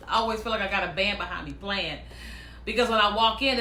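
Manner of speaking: ordinary talk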